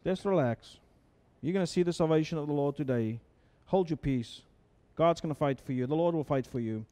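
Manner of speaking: soft, soothing Moses telling them to relax; calm reassurance. Acted. Moses calms two million scared Israelites, soft and soothing. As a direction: settle a frightened crowd gently. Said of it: "And he says".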